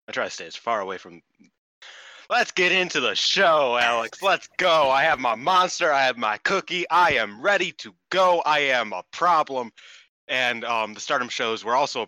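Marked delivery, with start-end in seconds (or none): 2.3-9.7 loud, slow hype-man intro; excited. Acted. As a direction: pump up the start of the show. Hyped opening of the show.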